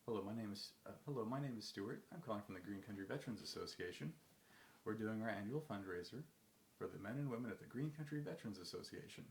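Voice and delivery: "Stuart" lifted up, final "Association" brought down; up-then-down inflection. Shown as a demonstration. Cold caller pitching a fundraiser, up-then-down inflection to "Association". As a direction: alternate rising and falling phrase ends, "Stuart" up, last "Association" down. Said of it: "an up and then down inflection".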